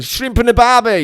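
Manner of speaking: high mock Aussie cry; loud, high Australian caricature; Australian accent. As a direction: ham up an Australian bloke. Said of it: "He's mad Australian cousins".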